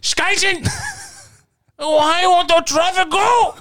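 high-pitched hammed-up German accent; mock German outburst, drawn out and very high; bad German accent. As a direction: rant slowly in a badly faked German accent. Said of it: "I'm not great with German accent".

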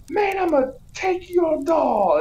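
high-pitched comic taunt; mock villain threat. Villain sliding in to grab a doll, high taunt. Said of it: "he slides down a wire".

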